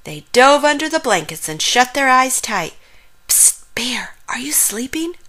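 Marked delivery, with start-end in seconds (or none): 3.3-5.2 secretive bedtime whisper. A narrator's reading. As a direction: whisper to check if someone is awake. Frog checks on Bear at bedtime.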